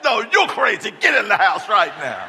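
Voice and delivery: loud, high, laughing order; laughing yell back. Retold or imitated. Dad ordering daughter inside, laughing loud yell. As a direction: boom it back at her half laughing. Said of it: "I said".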